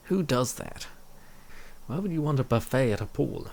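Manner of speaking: ordinary talk, nothing marked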